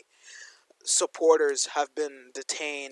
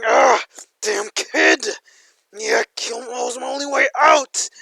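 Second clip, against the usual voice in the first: raging villain; 11 semitones higher, 11 dB louder than usual. Acted. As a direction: rant like a cornered villain losing it. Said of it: "Starts getting angry and foaming at the mouth".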